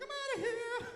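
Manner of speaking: high, loud, laughing "I'm outta here"; gleeful exit. Acted. Comic bragging to the crowd, high gleeful squeal. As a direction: squeal it as you moonwalk off. Moonwalking exit, acted quote.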